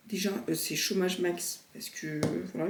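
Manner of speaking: ordinary delivery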